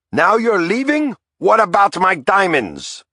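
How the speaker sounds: very high, aghast protest; anguished protest. A narrator's reading. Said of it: "Santinella cried".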